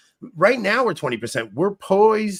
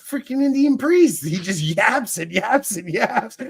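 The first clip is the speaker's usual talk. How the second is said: exasperated; 7 semitones higher, 4 dB louder, slower than usual. Real, not acted. Complaining about chatty priest.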